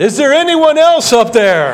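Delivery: loud, high-pitched yell; comic acted cry for help; calling out. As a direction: shout upward, desperate for another answer. Stranded man calls out again.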